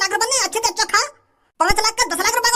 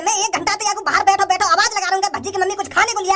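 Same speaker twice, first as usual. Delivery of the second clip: ordinary talk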